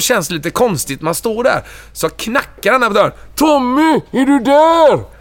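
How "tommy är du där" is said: high, slow call through a door. Retold or imitated. Knocking on the iron door, calling high to Tommy. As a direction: call to a friend behind a locked door.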